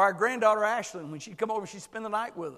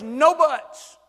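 God's firm reply; 3 semitones higher, 11 dB louder, much slower than usual. Pretend. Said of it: "And God says".

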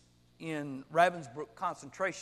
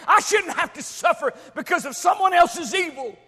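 loud, high-pitched outraged objection; indignant protest; 10 semitones higher, 12 dB louder than usual. Acted. Listener objects that suffering is not fair, indignant. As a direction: protest loudly, pitch up, building to a shout.

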